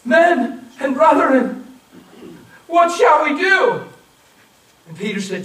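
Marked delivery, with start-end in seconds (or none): 0.0-3.9 desperate, high, slow shout; crying out. Retold or imitated. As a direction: cry out in anguish to the apostles. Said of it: "they cried out".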